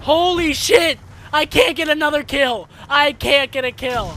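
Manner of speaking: furious gamer shouting at the screen; real rage at the game; raging yell. Real, not acted. Raging at no kills.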